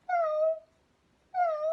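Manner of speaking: very high, loud mock meows; cat meow imitation. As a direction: mew loudly like a cat, way up high. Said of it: "Do you want me to do it again".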